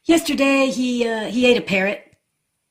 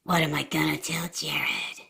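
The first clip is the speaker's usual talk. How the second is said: whispered to himself, much softer and lower; panicked whisper; 7 semitones lower, 8 dB quieter than usual. Acted. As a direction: kid frets alone about the lost dog, whispering. Alone after losing the dog.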